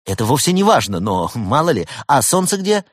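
bright-eyed lad answering the helpline; cheerful young technician. A narrator's reading. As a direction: play an upbeat young support worker. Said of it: "молодой и жизнерадостный".

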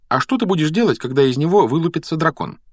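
put-on high girl's question; inquiring girl. A narrator's reading. Hermione asks about the hatching dragon, high inquiry. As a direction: ask pointedly in a high voice. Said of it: "поинтересовалась Гермиона".

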